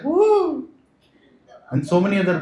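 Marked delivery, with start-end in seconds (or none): 0.0-0.7 high mock bird whoop; koel bird call. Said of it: "He comes and shouts like Kokila".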